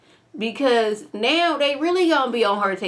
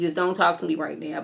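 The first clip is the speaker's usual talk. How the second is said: normal talking voice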